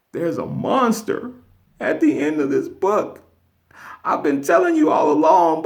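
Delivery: begging, climbing very high in pitch; pleading Grover. Retold or imitated. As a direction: beg like a desperate puppet, pitch soaring. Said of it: "He's pleading".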